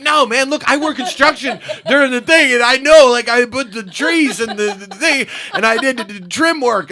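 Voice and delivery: frantic laughing backtrack; laughing explanation. Retold or imitated. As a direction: babble while cracking up.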